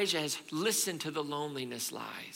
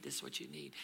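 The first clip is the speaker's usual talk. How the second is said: lower, breathy whisper; whispered repeat; 10 semitones lower, 12 dB quieter, faster than usual. Acted. Elijah whispering what he needs. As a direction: drop it to a whisper.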